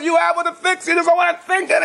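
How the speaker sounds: high-pitched mock screaming tirade; retold screaming rant. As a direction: go ballistic as the deranged fixer, pitch way up. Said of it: "He's screaming and yelling".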